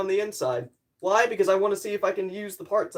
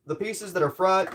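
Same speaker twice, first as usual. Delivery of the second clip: normal talking voice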